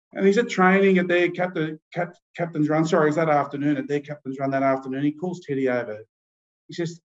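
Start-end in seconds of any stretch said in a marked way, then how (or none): none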